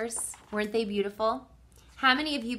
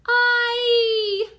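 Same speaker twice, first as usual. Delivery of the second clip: long, high, loud shriek; 11 dB louder than usual. A narrator's reading. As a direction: shriek it and hold the note. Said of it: "shrieked Flap".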